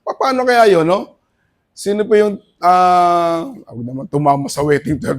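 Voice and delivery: usual speaking voice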